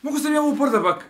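high mock voice; squeaky imitation of him; imitating a friend. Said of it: "on meni kaže".

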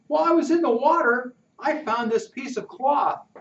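put-on high, fast boyish voice, excited; a kid rushing to show his find; boy Pudge, eager.

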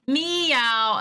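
high meow; cat meow. Pretend. Caller demonstrating her cat sound on hold. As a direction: show off your cat sound. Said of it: "very accurate cat sound".

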